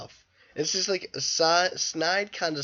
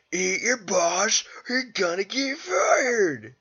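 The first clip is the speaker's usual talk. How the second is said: higher, slow, whiny crying delivery; mock wail; 6 semitones higher, 3 dB louder, slower than usual. Reviewer quoting his favourite line, slow mock wail. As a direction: wail it like an overwrought boss.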